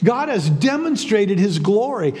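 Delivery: ordinary delivery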